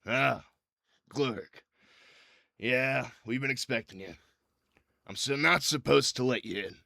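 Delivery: guard reluctantly turning someone away; gate guard. Acted. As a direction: greet a known troublemaker at the gate. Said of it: "You see the guard goes".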